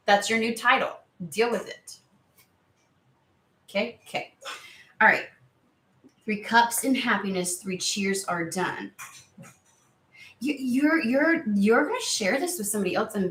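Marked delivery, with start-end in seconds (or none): none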